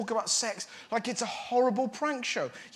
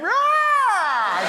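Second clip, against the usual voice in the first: mock ecstatic cry; 10 semitones higher, 8 dB louder than usual. Imagined lovely sex.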